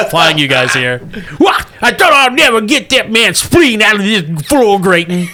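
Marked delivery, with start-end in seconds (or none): 1.4-5.3 hammy backwoods pilot shouting. Acted. GM voicing redneck pilot.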